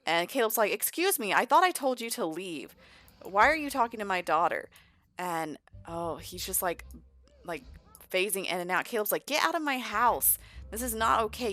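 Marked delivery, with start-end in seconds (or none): 0.7-4.7 raised, higher scolding voice; mock-indignant, demand an explanation; indignant father. Caleb catches Vlad talking to his daughter, indignant. 9.2-11.5 higher, louder indignant outburst; mock angry order. Caleb orders Vlad away from his daughter, angry.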